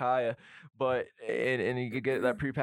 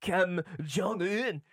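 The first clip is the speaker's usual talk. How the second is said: Kim Jong-un in a mock accent, stretched slow; 4 semitones higher, much slower than usual. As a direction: draw the name out in a put-on accent, higher. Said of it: "You must say it with the accent".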